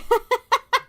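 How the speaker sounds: high, loud burst of giggles. Real, not acted. Streamer amused by a love line, giggling.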